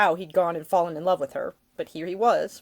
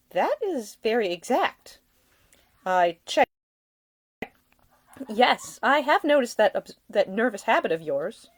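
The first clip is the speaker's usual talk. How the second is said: put-on teasing young lady, gently higher; lightly voiced young woman; 5 semitones higher, 4 dB louder than usual. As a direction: play her amused and a bit arch. Ida voiced by narrator.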